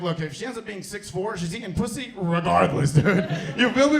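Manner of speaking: ordinary delivery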